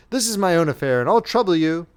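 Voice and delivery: stern, clipped authority; severe. A narrator's reading. Colonel rebuking the lieutenant, severe. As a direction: put the subordinate in his place. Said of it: "began the colonel severely".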